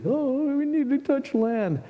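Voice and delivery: high, whining complaint; whiny man child. Retold or imitated. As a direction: whine it like a big baby. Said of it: "I whined like a baby".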